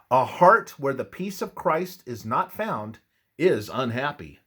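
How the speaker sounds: normal talking voice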